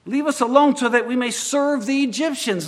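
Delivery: high, loud mocking complaint; sarcastic. A narrator's reading.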